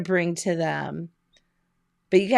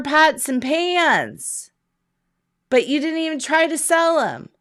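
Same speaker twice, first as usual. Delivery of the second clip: higher-pitched, lightly voiced townsfolk telling him off; retold townsfolk scolding; 7 semitones higher, 4 dB louder than usual. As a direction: play a neighbour half-scolding Zig, pitched up. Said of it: "And they said".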